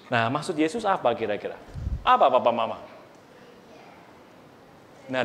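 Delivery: usual speaking voice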